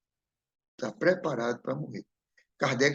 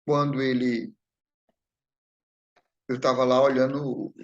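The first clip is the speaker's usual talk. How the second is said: ordinary delivery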